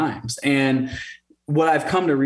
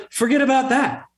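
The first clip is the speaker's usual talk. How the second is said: lightly voiced wife, much higher; a brisk, firm higher take on his wife; imitating wife; 10 semitones higher than usual. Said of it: "she goes".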